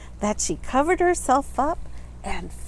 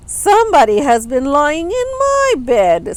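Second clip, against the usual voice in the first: middle-sized bear voice; 4 semitones higher, 7 dB louder than usual. A narrator's reading. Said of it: "in her middle-sized voice".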